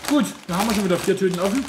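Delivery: excited. Real, not acted. Laughs at opened bags.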